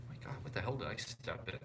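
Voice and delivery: whispered under the breath, startled; a real startled whisper to yourself. Real, not acted. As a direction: hush it out as you notice something on your shoe. Startled aside while stepping in something.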